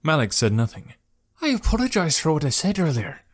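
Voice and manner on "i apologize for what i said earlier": high, meek character apology; calming down. A narrator's reading. Traveller to the angry archer, apologizing more calmly. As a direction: offer a tentative apology, no shouting. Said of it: "seeming to calm down a bit".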